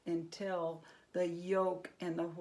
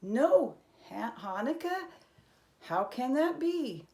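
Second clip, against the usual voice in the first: can't believe there's no Hanukkah; astonished disbelief; 5 semitones higher, 6 dB louder than usual. A narrator's reading. Herschel hearing the village has no Hanukkah, astonished. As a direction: pitch jumps up in shock on Hanukkah.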